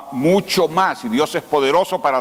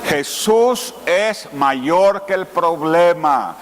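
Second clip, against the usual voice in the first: slow, higher, drawn-out proclamation; emphatic declaration; 4 semitones higher, much slower than usual. Shown as a demonstration. Preacher leads the congregation in declaring faith, slow emphatic proclamation. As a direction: proclaim it word by word at half pace. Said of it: "Dígalo".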